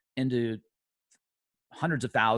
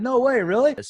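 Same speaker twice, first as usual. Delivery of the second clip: higher and louder, amazed; surprised disbelief; 12 semitones higher, 8 dB louder than usual. Retold or imitated. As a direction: blurt it out in happy shock. Surprised at meeting the house owners.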